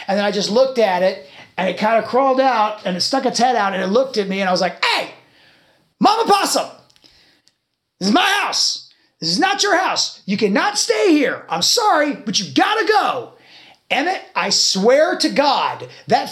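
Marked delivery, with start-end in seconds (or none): 4.8-13.3 high, emphatic telling-off in short bursts; firm retold ordering-out. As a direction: lay down the law to an animal. Retold firm address ordering possum out.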